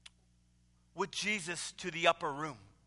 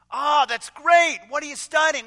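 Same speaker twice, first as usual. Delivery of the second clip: booming, much higher and louder hearty greeting; burly pastor; 6 semitones higher, 9 dB louder than usual. Retold or imitated. Burly pastor greeting the student at the door, booming and hearty. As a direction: boom it out like a jolly big pastor. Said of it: "he says to the young student".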